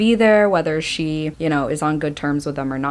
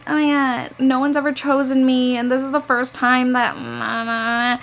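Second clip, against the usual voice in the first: whiny voice; 6 semitones higher than usual. Retold or imitated. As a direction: mimic a whiny narrator. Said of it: "she sounds even more whiny".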